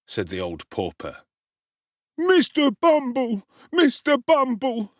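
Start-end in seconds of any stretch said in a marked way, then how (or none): 2.2-4.9 high, loud, slow crying out in feigned alarm; fake dismay. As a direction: wail for the beadle, overplaying it. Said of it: "cried Noah, with well-affected dismay".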